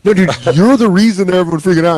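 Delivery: fast, exasperated pushback; a quick, fed-up friend's retort. Retold or imitated. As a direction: fire it back fast and fed up. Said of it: "And you're like".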